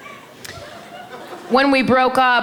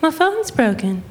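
high, plaintive mom impression; put-on high mom voice; mom's voice; 8 semitones higher than usual. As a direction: imitate mom in a higher pitch. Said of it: "she was like".

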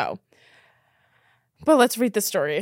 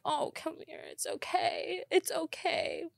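cooing; 7 semitones higher than usual. Retold or imitated. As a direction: coo like soothing a child. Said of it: "And I'm like".

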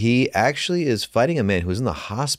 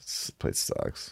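whispered aside; 9 dB quieter, much slower than usual. Pretend. Mock grumble about Groundlings.

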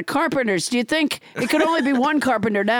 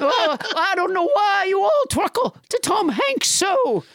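high, hammed-up lament; put-on character voice; 6 semitones higher than usual. Character scolds everyone over Tom Hanks, high put-on voice. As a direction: complain loftily in a high voice.

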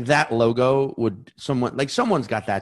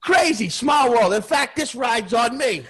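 put-on jolly old New York cabbie; 11 semitones higher than usual. Friendly cabbie greeting his passenger, high and laughing. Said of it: "expected him to just be like".

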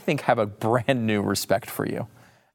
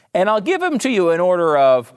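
usual speaking voice

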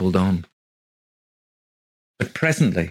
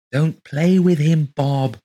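high, loud desperate plea; scream; 7 semitones higher, 6 dB louder, slower than usual. A narrator's reading. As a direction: cry out to stop him. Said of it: "Rose wanted to scream".

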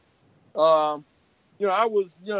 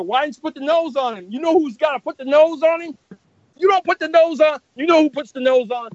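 louder, higher mock scolding of a junior; 7 semitones higher, 5 dB louder than usual. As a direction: lecture him like an irritated senior artist. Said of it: "that's the way he talked to me".

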